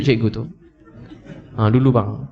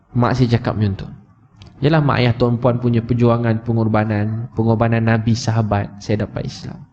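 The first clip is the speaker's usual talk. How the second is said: ordinary delivery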